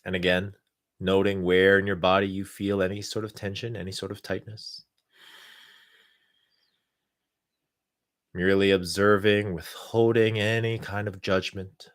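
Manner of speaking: calm, unhurried guidance with long pauses. Acted. Leads a guided meditation.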